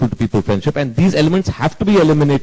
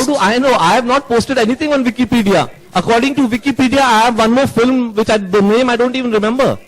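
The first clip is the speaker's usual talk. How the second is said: higher, irritated protest; exasperated denial; 7 semitones higher than usual. Real, not acted. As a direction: scoff at the accusation, pitched up. Director continues Wikipedia protest.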